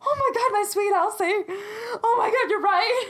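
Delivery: very high, theatrical maternal wail; imitating a mother bursting into tears; mock crying. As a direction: wail it in exaggerated heartbreak. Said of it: "And she starts crying too".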